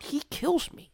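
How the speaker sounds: breathy imitated whisper; whispered aside. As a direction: hush it like a man facing a bear. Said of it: "he's over here like".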